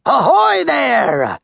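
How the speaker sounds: high, drawn-out pirate hail; calling out. A narrator's reading. Captain Red hailing Molly, long high call. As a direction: hail another ship across the water. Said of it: "Red called to Molly".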